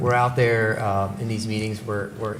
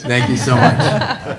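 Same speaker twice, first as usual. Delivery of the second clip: laughing; 3 semitones higher, 10 dB louder, slower than usual. Real, not acted. Thanks colleague for translating, laughing.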